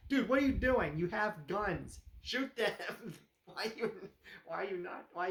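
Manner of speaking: exasperated viewer. Retold or imitated. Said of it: "It's like".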